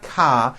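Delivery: "ka" ending on an open A, no R; R dropped. Shown as a demonstration. Teacher shows what it's not, "ka" with the R missing. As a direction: leave "ka" unfinished on the vowel. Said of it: "but it's not ka".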